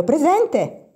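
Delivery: bright, high call of present; a schoolgirl lightly voiced answering the roll; lively answer. A narrator's reading. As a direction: snap out a quick, eager roll-call answer. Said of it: "rispondeva vivamente all'appello".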